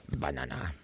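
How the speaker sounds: banana, murmured low and soft under the breath. Shown as a demonstration. Streamer trying out banana for viewers, soft and low. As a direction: say banana quietly, almost to yourself. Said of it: "I said banana in many different ways".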